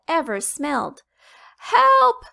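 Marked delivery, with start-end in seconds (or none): 1.6-2.3 put-on high, loud little girl shout; child's cry for help. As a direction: shriek it like a scared child.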